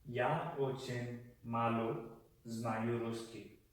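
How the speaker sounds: "Я очень мало ... знаю русский" slowed, with a pause midway; slow phrase demonstration. Shown as a demonstration. Teacher showing how to say I speak very little Russian, slow and broken up. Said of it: "you would say".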